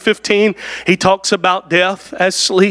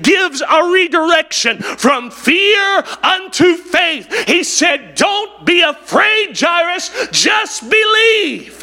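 preaching at full force; 8 semitones higher, slower than usual. Acted. Preacher crying out Jesus's words to the congregation, impassioned.

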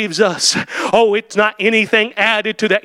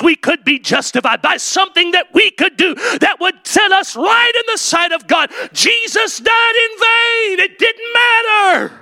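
preaching at full force; 6 semitones higher than usual. Acted. Preacher to his congregation, full-force high shouting. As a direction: hammer home that the cross was wasted.

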